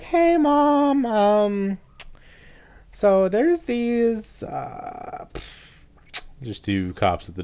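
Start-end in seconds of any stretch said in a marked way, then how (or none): 0.0-5.3 high, slow, hesitant squeak; nervous squeak. Retold or imitated. Teen telling mom about the cops, high nervous hesitation. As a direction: break it to mom slowly in a squeak.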